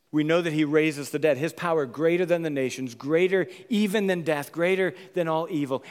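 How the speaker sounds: loud proclamation; preacher proclaiming power over death, loud; triumphant preaching. Acted. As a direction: declare it like a victory. Triumphant declaration.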